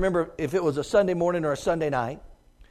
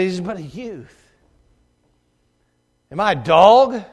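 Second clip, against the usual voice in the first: scoffing giant; 6 dB louder than usual. Acted. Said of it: "He scoffed at David".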